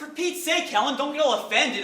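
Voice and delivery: exasperated protest. Acted. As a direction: protest with mounting irritation. Acted quarrel, he protests.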